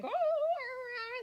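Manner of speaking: high howling imitation of a beagle. Beagle calling to the others, loud high howl. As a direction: howl it out like a hound. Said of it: "it's going".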